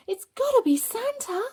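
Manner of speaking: high, loud thrilled cry; excited squeal. Acted. Child hearing Santa on the veranda, excited squeal. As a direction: squeal it like a kid who heard Santa.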